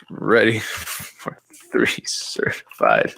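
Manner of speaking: slow, half-whispered mock copy; joking. Host typing joke sales copy for the class, joking murmur. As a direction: murmur it slowly as a sarcastic joke. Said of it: "I'm being sarcastic".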